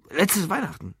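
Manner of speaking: high-pitched burst of remembering; sudden recollection. Acted. Drunk Lou suddenly remembers his last free day, blurting. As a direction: blurt it out as it comes back. Sudden recall after thinking hard.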